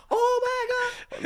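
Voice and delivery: shrieked very high and drawn out, mimicking the Spanish teacher's accent; hammed-up Spanish teacher, scandalised; mock Spanish accent, slow, very high and loud. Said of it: "She's going in her Spanish accent".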